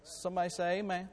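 usual speaking voice

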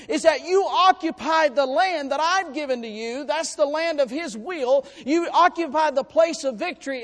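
loud, high preaching voice; full-force preaching. Acted. Preacher thundering to the congregation about victory.

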